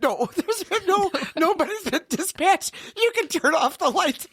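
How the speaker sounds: high, giggling dispatcher voice; laughing dispatcher. Retold or imitated. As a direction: squeak it out high, barely holding in the laugh. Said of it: "And she says".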